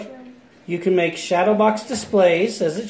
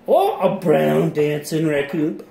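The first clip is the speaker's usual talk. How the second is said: ordinary talk, nothing marked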